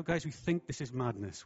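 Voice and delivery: ordinary delivery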